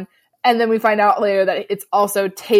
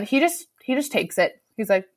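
ordinary talk, nothing marked

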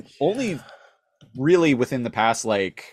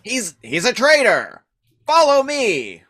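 much higher, loud, whiny traitor rant; rally the troops in a screechy villain voice; shrill Starscream rant; 10 semitones higher, 5 dB louder than usual. Retold or imitated. Starscream turning the Decepticons on Megatron, high and loud. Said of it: "He's like".

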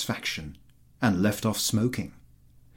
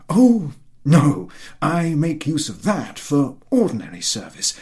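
lightly voiced fawning landowner, higher; eager flatterer; 6 semitones higher, 4 dB louder than usual. A narrator's reading. Chertakutski flattering the general about his horse, eager and higher. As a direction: fawn over the horse in a raised, eager voice.

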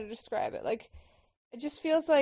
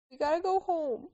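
high, pleading pup voice; playful high character dub; voicing film animal; 5 semitones higher than usual. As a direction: play a whimpering youngster begging. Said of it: "Doing that narrate thing again".

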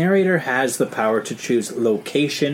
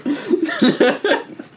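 high laugh. Real, not acted. Laughs at losing streak.

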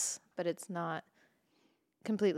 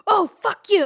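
higher, louder mock yelp of pain; pained outburst; 10 semitones higher, 11 dB louder than usual. Girlfriend hurt by the epilator, mock angry yelp. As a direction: blurt it out, stung and angry.

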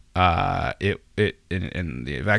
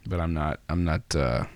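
ordinary talk, nothing marked

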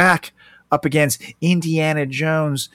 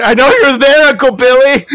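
much higher, loud mock Vader; a silly, raised-voice prank caller; Darth Vader on the phone; 11 semitones higher, 9 dB louder than usual. Host joking Vader calls his uncle, loud and high. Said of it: "that's Darth Vader, right?".

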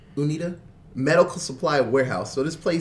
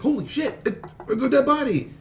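higher, half-laughing mock shock; excited shock; 10 semitones higher than usual. Friend retelling a movie scene, mock shocked and laughing. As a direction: act out the stunned discovery, laughing. Freddy sees the zombie.

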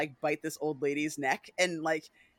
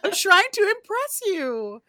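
much higher, louder theatrical whine; whiny Lestat; 9 semitones higher, 5 dB louder than usual. Retold or imitated. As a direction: whine like a needy diva. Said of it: "And Lestat's like".

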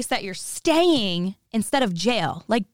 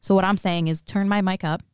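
ordinary talk, nothing marked

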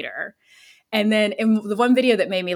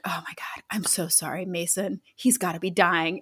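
much softer, hushed whisper to the son; whispered apology; 3 semitones lower, 7 dB quieter than usual. Retold or imitated. Mother whispers sorry to embarrassed son, hushed. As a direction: whisper it so the car can't hear. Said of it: "And she's like".

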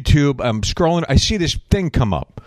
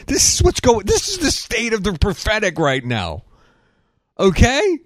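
exasperated; 8 semitones higher than usual. Real, not acted. Exasperated mock about prophetic state.

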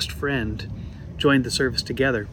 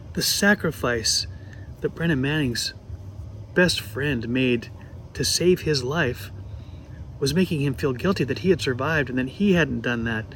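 usual speaking voice

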